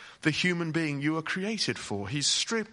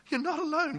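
"You're not alone" said much higher through a laugh; 13 semitones higher, faster than usual. Shown as a demonstration. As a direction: laugh as you repeat it, pitched up. Repeated line with laugh.

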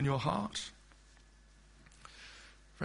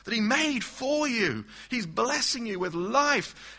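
a raised, earnest plea; pleading; 7 semitones higher, 6 dB louder than usual. Retold or imitated. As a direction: urge her to see God's goodness. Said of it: "you'd wanna say".

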